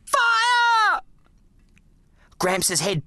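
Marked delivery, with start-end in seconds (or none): 0.1-1.0 high, loud shouted order; shouting. A narrator's reading. Boy screams the signal.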